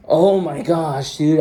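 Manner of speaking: normal talking voice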